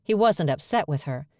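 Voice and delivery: ordinary delivery, nothing marked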